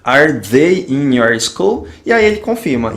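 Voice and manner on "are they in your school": devagar, bem articulada; pergunta lenta de professor. Shown as a demonstration. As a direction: dite a pergunta devagar pro aluno. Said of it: "ele vai para o início da frase". (frase em inglês lida bem devagar)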